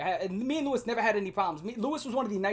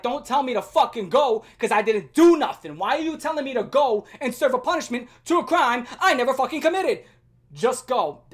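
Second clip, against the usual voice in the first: loud furious pushback; angry retort; 4 semitones higher, 8 dB louder than usual. Retold or imitated. Boy refusing teacher's order to go, loud angry retort. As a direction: fight back against the unfair order.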